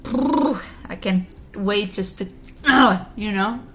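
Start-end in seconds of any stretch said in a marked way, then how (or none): none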